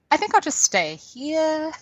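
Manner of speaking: mock resigned. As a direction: quote yourself deciding to stay put. Retells own reaction mid-quake.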